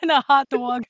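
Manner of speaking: put-on jokey New Yorker accent. Host adds to the hot dog bit, jokey New Yorker accent. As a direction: order like a mock New Yorker. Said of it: "that very New Yorker".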